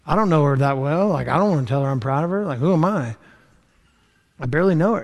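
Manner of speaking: half-laughing, shrugging it off; reluctant inner protest. Retold or imitated. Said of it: "I'm going".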